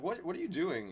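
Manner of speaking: indignant disbelief. Retold or imitated. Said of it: "I'm like".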